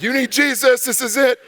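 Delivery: high-pitched mock-panicked evangelizing; urgent, hammed-up plea from a doomed flyer; freaking out. Nervous flyer evangelizing the person next to him, freaking out. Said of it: "I am just freaking out".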